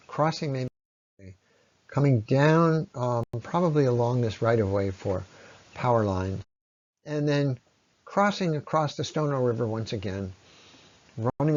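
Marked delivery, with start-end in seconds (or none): none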